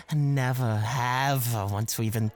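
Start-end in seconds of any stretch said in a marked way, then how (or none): none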